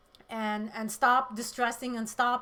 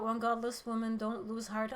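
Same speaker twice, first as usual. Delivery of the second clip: normal talking voice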